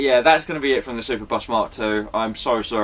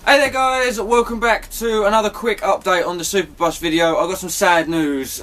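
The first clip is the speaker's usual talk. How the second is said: video intro; 6 semitones higher than usual. Acted. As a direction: greet viewers in a raised presenter voice. Another intro take.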